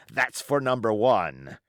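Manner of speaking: a scornful pirate captain holding his ground; defiant old sea-cook pirate. Acted. Pirate cook rebutting the mutineers' charges, defiant. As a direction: roll the words out like a seasoned buccaneer defending his command. Silver's speech continues.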